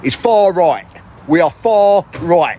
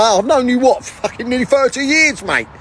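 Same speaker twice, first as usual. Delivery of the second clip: ordinary delivery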